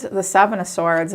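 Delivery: usual speaking voice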